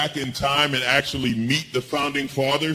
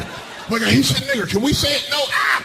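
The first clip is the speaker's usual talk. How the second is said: mock Fox News panic; 7 semitones higher than usual. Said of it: "They'd be".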